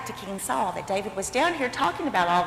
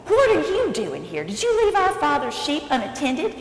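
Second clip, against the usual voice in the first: raised, scolding voice; yelling big brother; 5 semitones higher, 5 dB louder than usual. Acted. Big brother Eliab scolds David at the battlefield, yelling. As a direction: tell off a little brother loudly. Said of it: "yelled at David".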